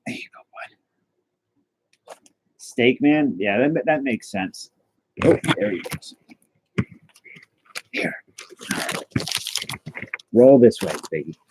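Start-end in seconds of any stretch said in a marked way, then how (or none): 0.1-2.1 very soft, slow drawn-out whisper; hushed and slow. Real, not acted. Whispering to the pet. 5.2-8.2 whisper. Real, not acted. Whispering to the pet.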